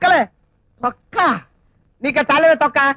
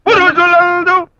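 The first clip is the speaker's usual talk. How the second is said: dramatic recitation. Acted. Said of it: "drama hall అనుకున్నారా".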